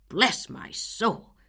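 very slow, loud bless my soul; outraged exclamation. Acted. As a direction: spit out the outrage. Angry neighbor exclaims.

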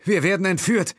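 high, loud alarm; alarmed call. A narrator's reading. Will cries out that they are kidnapped. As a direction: cry it out. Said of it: "rief er".

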